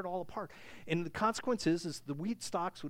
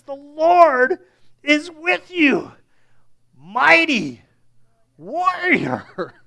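mock grand angel; 8 semitones higher, 16 dB louder, much slower than usual. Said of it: "it's hilarious".

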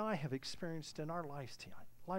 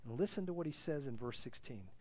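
normal talking voice

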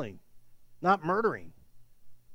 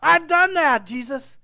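high, loud and eager, tumbling out fast; proud young man rushing to say he's kept every rule; much higher eager boast to Jesus; eager, quick reply; 11 semitones higher, 9 dB louder than usual. Acted. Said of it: "the man says simply, quickly".

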